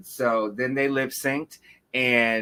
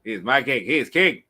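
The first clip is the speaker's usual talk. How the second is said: hype announcer intro. Pretend. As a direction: build him up like a champion. Hype-man introduces the host.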